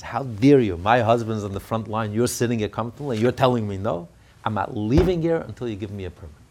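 retold defiant protest, loud and firm; indignant outburst. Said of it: "she started banging on the table".

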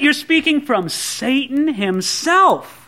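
normal talking voice

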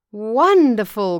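loud, drawn-out amazement; amazed gasp. A narrator's reading. Farmer marvels at the weaving, gasping in amazement. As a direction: exclaim slowly and loudly in awe. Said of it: "gasped the farmer".

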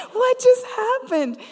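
high, loud, drawn-out disbelief; astonished. Retold or imitated. Speaker reeling after a grocery store prayer, astonished. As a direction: exclaim it slowly in amazed bewilderment.

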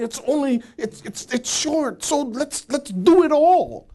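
mock live-it-all enthusiasm, rising to the end; eager YOLO believer. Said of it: "They say".

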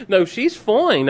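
laughing. Real, not acted. Still laughing after cracking up.

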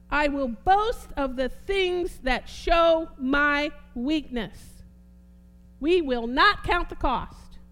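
loud, measured pulpit declaration; rousing, deliberate preacher's proclamation; full-force preaching. Real, not acted. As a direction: drive each phrase home with heavy stresses.

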